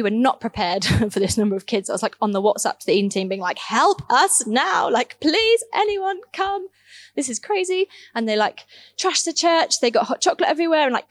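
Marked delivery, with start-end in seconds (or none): 3.6-7.9 high-pitched, drawn-out mock plea for help; panicked plea. Overwhelmed youth worker messaging the team for help, high panicked plea. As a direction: re-enact a desperate SOS, pitch climbing.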